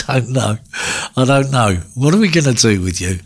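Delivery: normal talking voice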